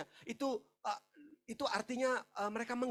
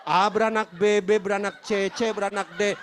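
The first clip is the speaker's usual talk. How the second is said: loud genealogy recitation at usual pitch; membaca silsilah; 11 dB louder than usual. Retold or imitated. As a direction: belt out a repetitive list of begats. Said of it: "Saya capek bacain orang beranak". (membaca silsilah dengan lantang)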